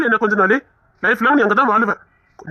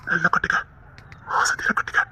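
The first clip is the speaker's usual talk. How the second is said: softer tender whisper; 5 dB quieter than usual. Acted. Husband holding his wife, whispering tenderly. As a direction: whisper it close to her ear.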